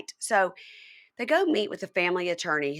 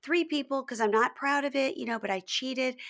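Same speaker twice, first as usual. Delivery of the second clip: put-on high baby voice; 7 semitones higher than usual. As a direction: quote her like a little girl. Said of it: "high-pitched baby voice".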